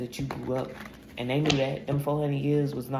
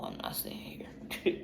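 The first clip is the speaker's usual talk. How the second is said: softer, slow unvoiced whisper; whispered resolve, slowed down; 7 dB quieter than usual. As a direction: drag it out in a hush.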